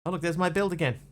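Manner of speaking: ordinary delivery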